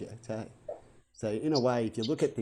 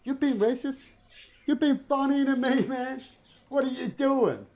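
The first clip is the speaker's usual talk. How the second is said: much higher, loud indignant retort; offended shout; 13 semitones higher, 7 dB louder than usual. Retold or imitated. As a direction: snap back hurt and offended, at full volume. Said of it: "They might go".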